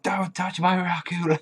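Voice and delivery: drawn-out put-on voice claiming Raku; mock jealous rival guarding her boy; possessive jealous girl. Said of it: "I was like".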